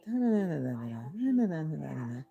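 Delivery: flat, even da-da-da drone; lightly imitated monotone chatter; monotone babble. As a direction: drone the syllables like a bored worker. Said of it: "Everything just sounded like".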